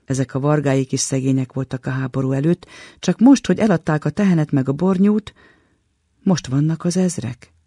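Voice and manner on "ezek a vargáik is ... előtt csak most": usual speaking voice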